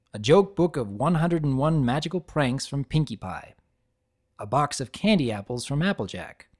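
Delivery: plain reading voice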